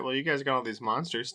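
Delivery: normal talking voice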